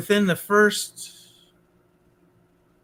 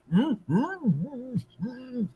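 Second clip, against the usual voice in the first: high muffled hums, fading softer and softer; muffled moans; 6 semitones higher, 7 dB quieter, much slower than usual. Retold or imitated. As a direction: moan into the pillow, unable to talk. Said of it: "And I'm like going".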